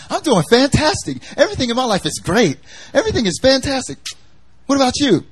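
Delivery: high, quick, gushing mock cheer; chirpy happy person. Preacher mimicking always-happy people, high and bubbly. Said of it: "every time you ask them".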